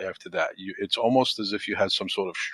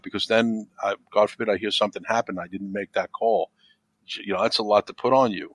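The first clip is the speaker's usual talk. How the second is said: usual speaking voice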